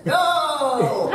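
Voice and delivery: loud, high strained yell, drawn out; imitated character yell. Fan imitates werewolf captain yelling, strained loud shout. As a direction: imitate a big-voiced actor yelling, pitch pushed up. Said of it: "when he had to yell, it would sound like this".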